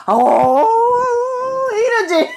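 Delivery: high, drawn-out pretend lament; mock mourning wail. Mother shows how real mourning would go, mock wail. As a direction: act out a wail of grief. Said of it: "내 진짜야 그랬다면".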